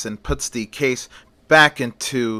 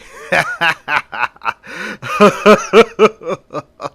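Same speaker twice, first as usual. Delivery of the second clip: high, louder mad cackle from off in the doorway; creepy laughter; 4 semitones higher, 6 dB louder than usual. Acted. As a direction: laugh like a lunatic hiding out of sight. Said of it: "heard laughter coming from the doorway".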